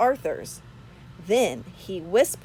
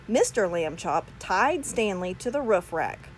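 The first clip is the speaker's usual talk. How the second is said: ordinary delivery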